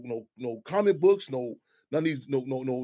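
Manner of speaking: usual speaking voice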